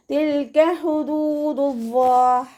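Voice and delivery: തിൽക with half sukun on ല്, slow chant; tajweed recitation. Shown as a demonstration. Teacher models the phrase for students, slow chant. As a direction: recite ഹുദൂദുല്ലാഹ് high and drawn. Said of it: "ഹ എന്നുള്ള അക്ഷരത്തിൻ്റെ സൗണ്ടിനെ".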